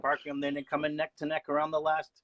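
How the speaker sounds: excited high race call; race announcer. Pretend. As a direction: call the last lap like a commentator. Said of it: "how about you call the final half a lap of a race?".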